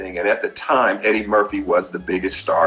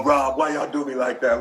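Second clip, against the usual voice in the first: laughing Eddie; 4 semitones higher than usual. Retold or imitated. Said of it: "he just goes".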